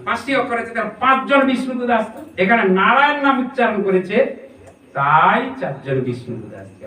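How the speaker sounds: ordinary talk, nothing marked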